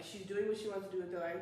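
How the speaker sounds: normal talking voice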